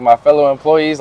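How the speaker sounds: ordinary delivery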